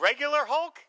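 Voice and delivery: high, startled question; incredulous question. Retold or imitated. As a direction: ask in disbelief. Sound guy asks incredulously.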